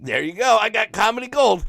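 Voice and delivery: delighted he has found his material; high thrilled voice, comedy gold found; gleeful. Retold or imitated. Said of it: "I'm like".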